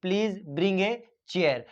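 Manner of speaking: very slow, each word spaced out; slow dictation. Shown as a demonstration. Teacher dictating the example sentence to the class, very slowly. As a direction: dictate it word by word so students can write. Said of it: "The she said".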